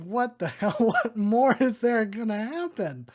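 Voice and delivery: loud, high, laughing disbelief; exasperated outburst. Real, not acted. Watching the movie at home, baffled there's half an hour left, loud laughing outburst.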